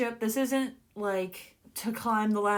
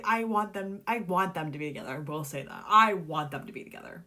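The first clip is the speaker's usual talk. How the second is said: ordinary delivery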